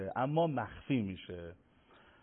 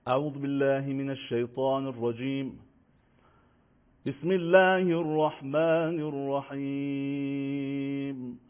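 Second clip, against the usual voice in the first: slow, higher, melodic tajwid chant; Quran recitation; 4 semitones higher, much slower than usual. Acted. Recites Surah Ghashiyah. (تلاوت آهنگین و کشیدهٔ قرآن)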